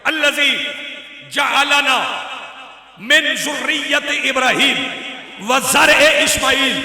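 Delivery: loud, high fervent sermon delivery; preaching. Acted. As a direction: proclaim it with full force, drawn out. Recites the sermon at full force. (मजलिस में खुतबा सुनाते हुए जोशीला बयान)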